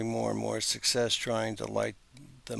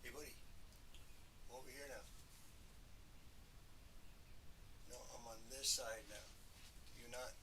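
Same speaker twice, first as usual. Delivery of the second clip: distant voice calling out across the room; calling from far away; 20 dB quieter than usual. Real, not acted. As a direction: call out from far off. Calling out to someone unseen.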